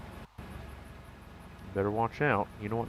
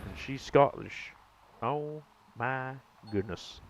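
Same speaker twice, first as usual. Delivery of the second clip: normal talking voice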